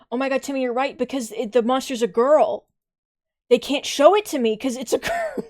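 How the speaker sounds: loud, high excited outburst; laughing with delight. Real, not acted. Excited reaction to chat.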